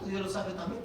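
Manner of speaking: ordinary talk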